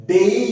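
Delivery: ডেই with a gliding ei vowel, high and loud; teacher models ডেই, vowel sliding from e to i; diphthong in ডেই. Shown as a demonstration. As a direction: draw out the ei glide in ডেই. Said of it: "এই, এটা একটা diphthong".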